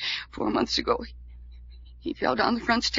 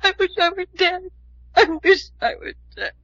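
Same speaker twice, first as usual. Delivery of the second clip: anguished despair; 6 semitones higher, 5 dB louder than usual. Acted. Distraught repetition.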